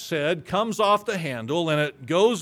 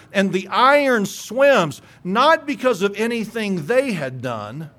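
preaching; 4 semitones higher, 4 dB louder than usual. Acted. Preaching the miracles to the congregation.

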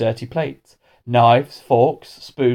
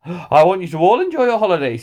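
raised, hearty assembly voice; headmaster addressing school; 7 semitones higher, 4 dB louder than usual. A narrator's reading. Headmaster wishing the whole school a good holiday, hearty. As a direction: address the whole hall brightly.

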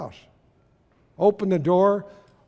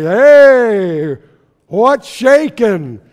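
booming, slow and much louder greeting; huge voice; 3 semitones higher, 10 dB louder, much slower than usual. Retold or imitated. Pastor voicing Big Steve's greeting, booming loud. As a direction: boom it out like a big friendly man. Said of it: "in his huge voice".

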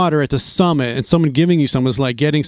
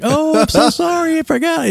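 much higher, put-on girly apology; mimicked selfie girl; 13 semitones higher than usual. Selfie girl apologizing to the dad, high mimicked voice. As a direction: jump up high for a mock-ditzy apology.

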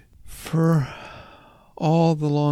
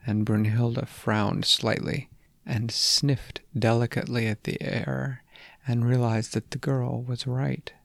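usual speaking voice

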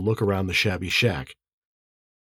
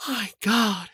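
high, hushed woman's exclamation; shocked; 12 semitones higher, 4 dB quieter than usual. A narrator's reading. Beth hears of the forgery, hushed shock. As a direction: say it softly, high and stunned.

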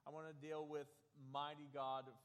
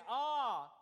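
loud pained Ah, pitched up; painful groan; 7 semitones higher, 11 dB louder than usual. Retold or imitated. Preacher showing the Hebrew word to the congregation, pained groan. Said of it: "this painful groan".

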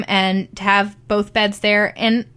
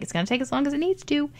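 quick, soft, higher-pitched retold answer; matter-of-fact; 6 semitones higher, 7 dB quieter, faster than usual. Midwife to impatient patient during stitching, matter-of-fact. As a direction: quote a midwife brushing off the question. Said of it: "she was very matter of fact".

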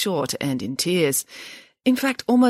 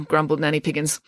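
ordinary delivery, nothing marked

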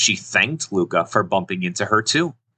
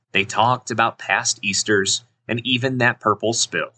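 plain reading voice

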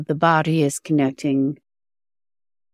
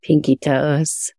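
guided meditation. Acted. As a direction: guide attention gently through the body.

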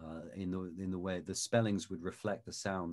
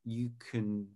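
normal talking voice

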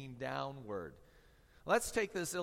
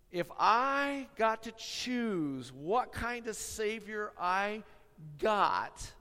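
sarcastic; 5 semitones higher, 6 dB louder, slower than usual. Pretend. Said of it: "I'm gonna say it with a little sarcasm in it".

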